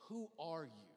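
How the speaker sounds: low, quiet confrontational question; restrained questioning. Retold or imitated. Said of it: "They finally get so angry".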